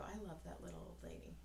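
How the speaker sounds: soft, fast aside; quiet aside. Real, not acted. Mother telling son an aside, soft and fast. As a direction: murmur it fondly and quickly.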